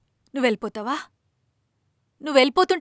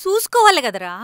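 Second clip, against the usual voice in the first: louder, higher reproach; scolding; 6 semitones higher, 6 dB louder than usual. Acted. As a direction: reprove him sharply. Mother scolds injured son. (గట్టిగా మందలింపు)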